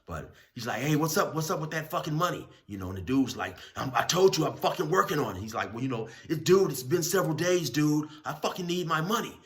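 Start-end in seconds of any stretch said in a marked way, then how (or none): 0.8-2.5 higher, louder demand; imitated confrontation, higher and louder; confronting inmate. Young dude confronts debtor. 3.8-5.4 higher, louder annoyed retort; retold heated reply, raised pitch; irritated insistence. Big dude insists he's working. 5.7-9.4 much higher, louder demand; imitated frustrated inmate, higher and louder. Young dude frustrated, demanding.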